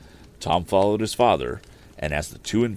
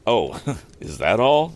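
amused chuckle; 5 semitones higher, 5 dB louder, slower than usual. Acted. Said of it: "rejoined Mister Swift with a smile".

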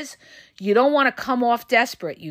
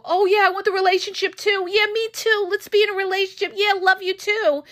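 higher, fast, gushing parody of a desperate lover; mock eager partner; 6 semitones higher than usual. Mocking the desperate partner who says yes too fast, high gushing. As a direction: rattle it off like someone too keen to commit.

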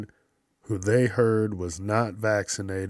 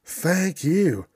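gleeful covid; 3 semitones higher than usual. Retold or imitated. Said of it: "covid's gonna be like".

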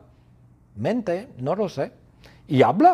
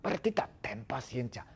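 whispered plea for patience; very quiet, restrained appeal; hushed pleading; 12 dB quieter than usual. Acted. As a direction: drop to a soft whisper. Shout drops into pleading hush.

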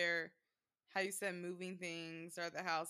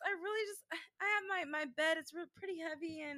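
put-on baby talk; 8 semitones higher than usual.

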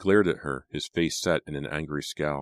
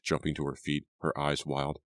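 ordinary delivery

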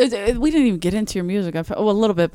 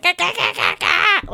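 high gah noises; 11 semitones higher than usual. Retold or imitated. Imitating frantic noise.

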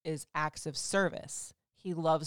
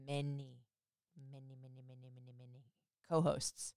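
fading repetition; 6 semitones lower, 12 dB quieter, faster than usual. Real, not acted. Trailing count of many cohosts.

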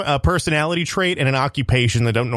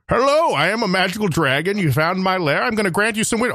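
a proud dragon announcing itself; magical dragon; 7 semitones higher than usual. Acted. Magical dragon greeting a wish-seeker. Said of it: "A magical dragon granting a wish".

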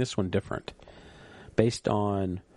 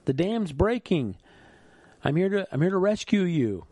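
much higher, urgent call from above; megaphone shout; 8 semitones higher than usual. Retold or imitated. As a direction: yell down through a megaphone. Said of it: "gets on a megaphone and says".

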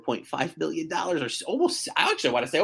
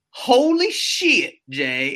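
higher, louder, slow Southern "Holy shit" with shit stretched to Shiat; Southern drawled shit; 7 semitones higher, 6 dB louder, much slower than usual. Retold or imitated. Shocked Texan client seeing New York taxes, Southern drawled shit. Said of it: "shit was literally like Shiat".

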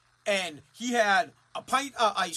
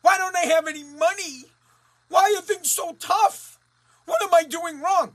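whiny mock complaint; 6 semitones higher, 6 dB louder than usual. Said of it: "people continue to waste money and they say".

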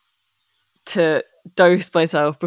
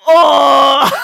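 loud shocked cry into a laugh; surprised shout; 9 semitones higher, 7 dB louder than usual. Real, not acted. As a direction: gasp out loud, then crack up. Shocked at rival's huge buy.

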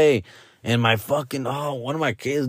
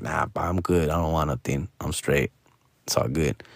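put-on lower, softer laid-back kid; easy, no-need-for-anything calm; chill middle son; 10 semitones lower, 6 dB quieter than usual. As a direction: middle son tells dad he's good, lower soft voice.

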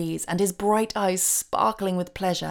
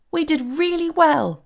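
high, louder, delighted cry; pleased fairy; 10 semitones higher, 5 dB louder than usual. A narrator's reading. Fairy reporting to Santa on the toy deliveries, pleased and high. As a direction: cry it out with pleasure, pitched well up. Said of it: "cried the fairy in a pleased voice".

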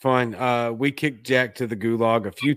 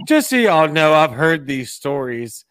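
normal talking voice

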